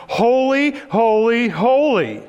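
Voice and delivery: high, loud ringing cry of praise; seraph shouting. Acted. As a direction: call it out loud like an angel. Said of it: "one of them starts shouting".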